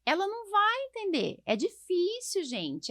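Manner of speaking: high exasperated complaint. Real, not acted. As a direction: pitch jumps way up in exasperation. Exasperated about confused parents.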